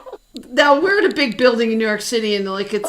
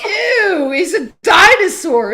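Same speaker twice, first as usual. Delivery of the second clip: a high, louder sneering "ew" that mocks him; mock disgust; 7 semitones higher, 4 dB louder, slower than usual. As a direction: retell a teenage punk jeering at an old star, a little hammed-up. Punks mocking [name].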